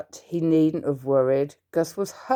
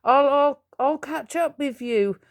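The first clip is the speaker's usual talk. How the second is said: stammering, breathless from the chase; high, winded, struggling to keep up; breathless halting promise; panting; 8 semitones higher than usual. A narrator's reading. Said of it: "he panted".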